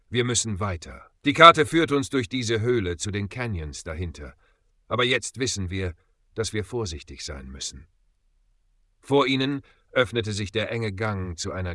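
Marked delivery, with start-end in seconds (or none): none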